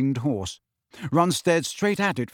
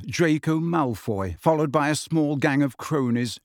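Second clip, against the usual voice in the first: ordinary delivery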